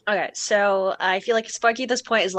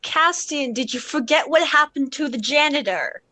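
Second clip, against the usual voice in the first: raised pitch, disbelieving caution; worried objection; 5 semitones higher than usual. Acted. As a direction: gently remind them of the danger, pitched up. Sparky cautions Castian against the plan.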